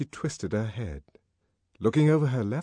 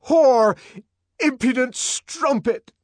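loud, high raging insults; furious shout; 9 dB louder, slower than usual. A narrator's reading. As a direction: shout abuse at her in fury. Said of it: "he shouted".

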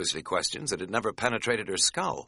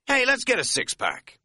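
sudden loud, high party call; 5 dB louder than usual. A narrator's reading. Shopper to his friend in the store, sudden party voice. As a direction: burst out like the party has started. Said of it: "he said suddenly in a party voice".

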